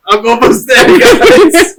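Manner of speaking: high, laughing strained effort; mock straining. Injured man lifting his arms for the rescuers, mock straining. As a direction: act out an agonized lift, half laughing. Acts out struggling to lift arms.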